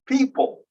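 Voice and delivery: "People" with a puff of air on the first P only; aspirated first P. Shown as a demonstration. Teacher showing the class English aspiration, aspirated first P in "People". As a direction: say "People", aspirating the opening P, not the middle one. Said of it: "The P is aspirated on the first one".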